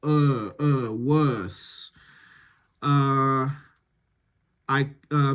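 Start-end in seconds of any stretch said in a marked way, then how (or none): 0.9-1.9 "Worse" rounded, with a soft, barely there R; softer R. Shown as a demonstration. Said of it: "soften that R".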